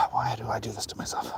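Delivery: weary whisper. Real, not acted. Walking up a hill, tired.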